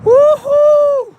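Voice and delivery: high, loud excited cheer; excited whoop. Real, not acted. Whoop in the wind.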